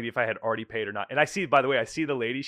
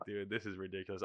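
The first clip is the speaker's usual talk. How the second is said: much softer, under-the-breath disbelief; leaving the spa, muttering softly in disbelief; muttered aside; 5 semitones lower, 15 dB quieter than usual. Retold or imitated. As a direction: mutter it to yourself.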